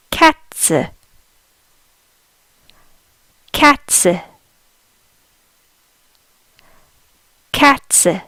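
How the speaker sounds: Katze with a sharp ts and a short final e; German Katze. Shown as a demonstration. Teacher modelling Katze for learners, careful German pronunciation. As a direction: say Katze crisply, ts then a light e. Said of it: "We say".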